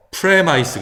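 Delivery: premise misread as "preh-MICE"; mistaken reading. Shown as a demonstration. Teacher warning against a misreading, premise pronounced "preh-MICE". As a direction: end premise wrongly on a long "eye" vowel. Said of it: "그러면 안 된다는 거죠".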